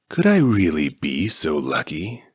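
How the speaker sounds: slow, sinister mocking villain. A narrator's reading. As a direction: gloat slowly over the trapped prey. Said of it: "Zenga's voice mocked us".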